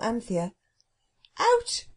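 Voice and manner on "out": very high, indignant exclamation; cook's incredulous outburst; outraged echo. Acted. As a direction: squawk it back in disbelief. Angry cook echoes Anthea's word.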